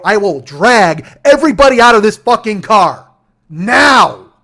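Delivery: high, loud shout with a bellowed last word; furious threat. Retold or imitated. Driver threatens to drag passengers out, furious shout. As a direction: roar it as a final ultimatum.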